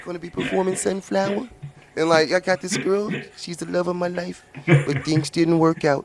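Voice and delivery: high, bassless cartoon voice; squeaky mock singer intro; cartoon character voice. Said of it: "sound like a Saturday morning cartoon character".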